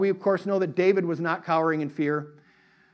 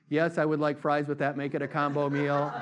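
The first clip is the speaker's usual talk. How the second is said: low, soft, monotone reply; entranced, obedient drone; mind-tricked customer; 3 semitones lower, 5 dB quieter than usual. Acted. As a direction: order it like you're under a spell. Hypnotized customer echoes the suggestion.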